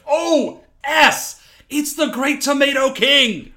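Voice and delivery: high, loud frightened cry; panicked shout. Retold or imitated. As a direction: yell it out in sudden terror at the monster. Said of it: "He just freaks out and runs".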